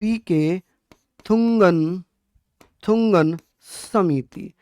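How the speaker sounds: each word set apart by long pauses, like dictating to a class; slow dictation. Shown as a demonstration. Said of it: "यहाँ पर हम बात करेंगे पीके थुंगन समिति".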